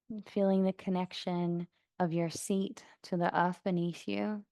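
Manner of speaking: soft, even meditation voice with long pauses; guided meditation. Acted. Yoga teacher guiding class to notice sounds around them, calm meditation voice. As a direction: settle them gently into stillness, unhurried.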